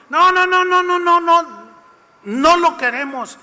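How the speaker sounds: loud high repeated no; angry mob refusing Pilate's offer, shouting; crowd shouting no. Acted. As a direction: hammer the no again and again. Crowd rejecting Jesus' release.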